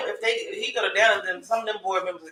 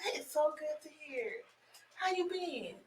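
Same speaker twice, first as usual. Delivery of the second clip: very soft, high coo; gentle high-pitched cooing; 5 semitones higher, 16 dB quieter than usual. Real, not acted. Baby talk to visiting infant.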